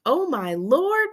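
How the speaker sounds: high, drawn-out exclamation; screamed dismay. A narrator's reading. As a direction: wail it like a horrified mama. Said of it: "Mama screamed".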